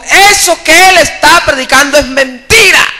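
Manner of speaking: high-pitched, insistent accusation; mock heckler shout. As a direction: imitate a heckler calling out in protest. Heckler accuses preacher, retold.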